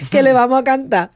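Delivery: excited call. Retold or imitated. Mother announcing singing to child.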